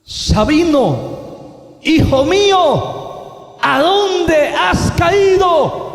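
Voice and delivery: high, slow, booming call; voice from the radiance. Retold or imitated. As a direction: stretch each word into a loud heavenly summons. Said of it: "volvió a hablar aquella voz".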